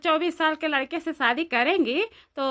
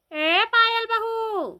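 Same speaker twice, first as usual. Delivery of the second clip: long, drawn-out call, much higher; stretched high hail to a neighbour; 8 semitones higher, much slower than usual. Acted. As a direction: call her name across the yard, slowly. Calling Payal from outside.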